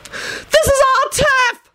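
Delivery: very high, drawn-out screech; shrill scream. A narrator's reading. Woman defends her turf to intruders, shrill screaming. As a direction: screech it shrilly at the top of your range. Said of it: "screamed shrilly".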